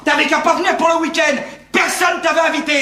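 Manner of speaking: high-pitched quarrelsome outburst; angry reproach. Acted. Brother reproaching an uninvited guest, angry quarrel. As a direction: throw the blame back, voice high. Brothers blaming each other.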